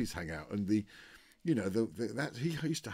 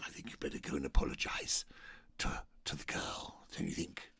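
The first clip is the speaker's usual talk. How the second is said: menacing whisper; 6 semitones lower than usual. Retold or imitated. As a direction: hush it like a threat. Oliver quietly ordering apology.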